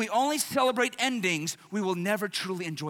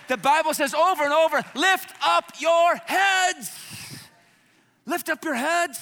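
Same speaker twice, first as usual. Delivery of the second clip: preaching at full force; 7 semitones higher, 7 dB louder than usual. Acted. Pastor to congregation, loudly urging them to lift heads. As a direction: call it out from the pulpit.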